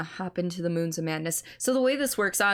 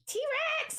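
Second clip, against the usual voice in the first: high shrieking dino cry; dinosaur roar. Pretend. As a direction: shout like a T-Rex. Pretending to be a dinosaur.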